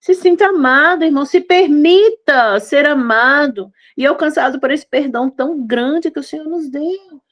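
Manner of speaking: ordinary talk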